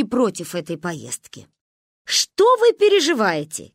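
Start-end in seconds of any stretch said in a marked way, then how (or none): 2.0-3.7 high, loud, exasperated protest; heated. A narrator's reading. Father brushing off the women's worries, heated. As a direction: boil over with exasperation. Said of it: "кипятился папа".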